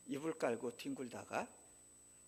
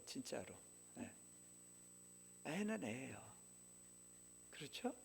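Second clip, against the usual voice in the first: ordinary talk, nothing marked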